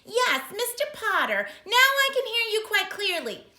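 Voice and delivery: high, loud prim clerk; clerk on the phone. Acted. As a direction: sound like a fussy official.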